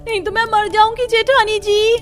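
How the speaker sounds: hammed-up terror, calling for help; fake panicked screaming. Younger wife faking panic to lure sister-in-law into kitchen. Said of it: "डर के चिल्लाने की ... drama करती हुई".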